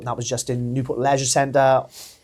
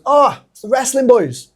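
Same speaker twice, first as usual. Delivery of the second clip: policeman voice; 9 semitones higher, 7 dB louder than usual. Retold or imitated. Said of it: "and he goes".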